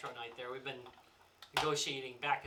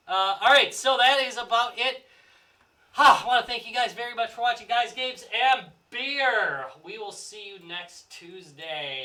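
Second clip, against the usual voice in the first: announcer voice; 5 semitones higher, 10 dB louder, slower than usual. Acted. Show sign-off.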